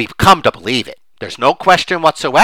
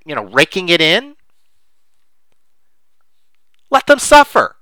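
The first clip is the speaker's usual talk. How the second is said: indignant outburst; 3 semitones higher, 6 dB louder than usual. Retold or imitated. Index investor outraged at others' gains, loud indignant shout. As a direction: spit out the resentment of a burned investor. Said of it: "The emotion underneath is".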